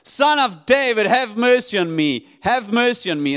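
a preacher's contained version of a desperate shout; screaming out. Acted. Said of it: "he's screaming this out".

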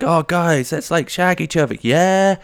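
high, teasing put-on friend voice; friend's voice. School friend suggests it to the class, high teasing voice. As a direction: sound sheepishly keen, high. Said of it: "he was like".

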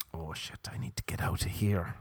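hushed, voiceless escape plan; soft whisper to self; whispered aside. Acted. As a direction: whisper it as a secret thought. Acted inner panic aside.

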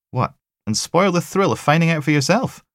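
high, mocking tease; teasing tech. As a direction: tease him about the thrill.

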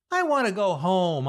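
whiny little complaint, sliding down; whining. A narrator's reading. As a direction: whine like a homesick kid. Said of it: "I whine".

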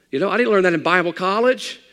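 loud, high, driving sermon climb; preaching at full force. Acted. Pastor preaching to his congregation, full force. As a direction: build it like a revival preacher.

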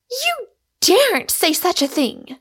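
high, loud outraged cry; enraged cry. A narrator's reading. Rosamond rebukes Michael for his suggestion, crying in rage. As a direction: explode in rage, voice pitched right up. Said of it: "cried Rosamond in a rage".

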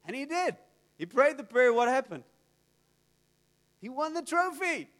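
ordinary talk

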